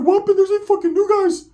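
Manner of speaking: very high, squeaky mock voice; high cartoon voice imitation; Ricky's voice. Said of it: "he's like".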